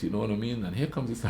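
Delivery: ordinary talk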